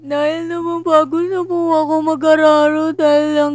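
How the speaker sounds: put-on high, slow melodramatic child; tearful child. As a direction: plead like a weary radio drama kid. Voicing the child, radio drama.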